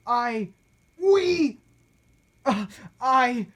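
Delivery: broken, halting stammer; stuttering. A narrator's reading. Cornered mayor stammering to captives, halting and high. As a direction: trip over the words in panic.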